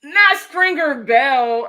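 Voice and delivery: loud, high and drawn out; amused outburst. Real, not acted. Streamer reacting to a viewer's joke about The Wire, amused outburst. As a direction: exclaim it slowly, on the edge of a big laugh.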